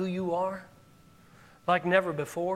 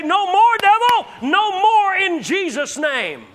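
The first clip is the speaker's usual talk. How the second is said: high, loud, slowing rebuke; thundering rebuke; commanding shout; 10 semitones higher, 8 dB louder, slower than usual. Acted. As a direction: rebuke the devil with full force. Commanding the devil in sermon.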